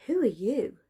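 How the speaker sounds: put-on curious girl. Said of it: "out of pure curiosity".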